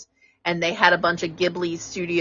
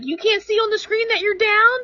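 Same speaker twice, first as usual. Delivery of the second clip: much higher whiny voice; mocking friend; 14 semitones higher than usual. Friend mocking her teammate's complaint, high whiny. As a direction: mimic his complaining. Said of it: "you're like".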